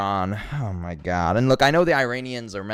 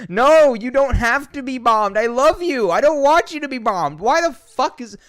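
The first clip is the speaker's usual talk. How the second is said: very high, loud wail of protest; loud, high-pitched anguished outburst; distressed outcry; 13 semitones higher, 8 dB louder than usual. Real, not acted. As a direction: cry out high and loud, pleading. Distressed reply to friend facing bombing.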